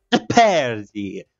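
put-on slow, stretched Italian accent on Jeopardy. Gamer joking that Jeopardy sounds Italian, mock Italian accent. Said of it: "maybe he is Italian".

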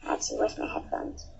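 whispered aside. Acted. Aside while playing.

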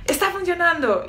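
high-pitched acted triumph; elated entrepreneur. Entrepreneur back on a high, elated. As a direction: cry out as if it's finally working. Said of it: "Después vuelve a subir y dice".

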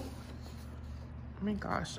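breathless whispered awe; awed whisper. Real, not acted. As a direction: whisper it, amazed. Awed at beautiful stamp set.

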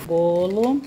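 ordinary talk

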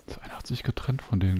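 soft hunting whisper. Real, not acted. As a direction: whisper so nothing hears.

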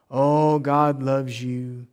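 very slow, drawn-out mock reassurance; syrupy mimicry. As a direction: stretch it out like a feel-good preacher. Mimics preaching only love.

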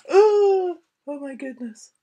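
high loud shocked oh; shocked cry. Acted. Reader reacts to the lightning flash. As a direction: gasp in alarm.

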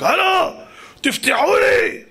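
high-pitched, drawn-out yell; shouting to be let in. Acted. King calls at the gates of Eden, shouting. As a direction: call out slowly and high at a locked gate. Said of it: "אז הוא התחיל לצעוק".

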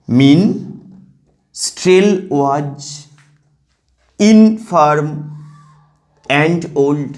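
very slow, split into two-syllable chunks; drawn-out, segmented verse reading; reading in pairs. Shown as a demonstration. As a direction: scan it foot by foot with long gaps. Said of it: "দুটো দুটোকে নিয়ে জোর করবে".

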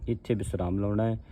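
ordinary talk

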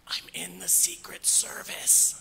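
breathy secret whisper leaned in close. Acted. Jim whispering to the pastor, a hushed secret whisper. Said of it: "he leans in to whisper".

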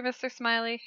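ordinary talk, nothing marked

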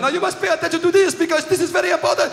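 high-pitched urgent French guide; mock French accent, straining over a din. French guide over the din of dogs, high French-accented instructions. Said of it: "this French guy is going".

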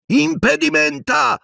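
very high, drawn-out shout; yelled spell. A narrator's reading. Harry casting at the Death Eaters from the ground, yelling. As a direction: hurl the incantation out at high pitch. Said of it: "urlò, rotolandosi ancora".

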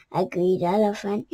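usual speaking voice

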